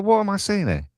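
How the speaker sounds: high-pitched retold disbelief; shocked bewilderment. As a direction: question it in mock astonishment. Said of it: "it was just shocking to see".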